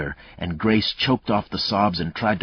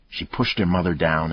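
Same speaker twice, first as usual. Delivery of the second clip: plain reading voice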